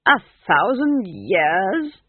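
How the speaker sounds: loud, slow, rising disbelief; appalled, drawn-out question; astonished. A narrator's reading. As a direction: echo it in stunned disbelief. Ghost astonished at the age.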